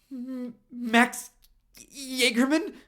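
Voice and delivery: gasp, then the name pitched high and loud; shocked gasp. A narrator's reading. Richie gasping as he recognises the jock. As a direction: blurt the name in alarm.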